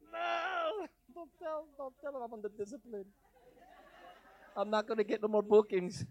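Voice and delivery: high, thin comic wail; hammed-up helpless complaint; mock whining. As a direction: plead in a small high put-on whine. Mock whining in private about discipline.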